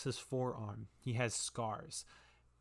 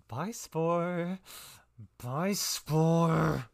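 higher, louder repeated calling; eerie, higher call, louder second time; eerie echoing call; 5 semitones higher, 8 dB louder, much slower than usual. Acted. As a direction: call the name through the connection, rising louder. Mind voice echo calling name.

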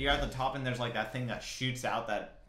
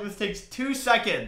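high, loud, drawn-out plea; retold indignant whine; exasperated protest; 10 semitones higher, 8 dB louder, slower than usual. Retelling their exasperated protest.

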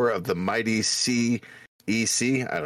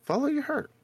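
much higher, sing-song advice; high-pitched; 14 semitones higher than usual. Pretend. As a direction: squeak out the advice playfully. Repeated line.

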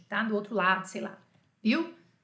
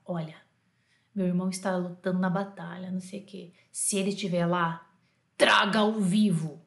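low, dramatic movie delivery; low voice building to a firm command; war film line; 5 semitones lower than usual. Acted. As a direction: play it like a grave war-film hero. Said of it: "Filme de guerra".

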